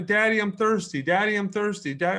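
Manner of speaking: pestering little daughter. Retold or imitated. Small daughter keeps pestering dad for water, mock childish nagging. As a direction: imitate a small kid pleading over and over. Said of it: "so she kept".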